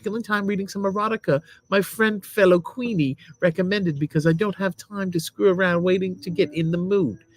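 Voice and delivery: high-pitched lady reading a review; put-on lady voice. Husband reading a product review as a lady, put-on lady voice. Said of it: "you have to be a lady".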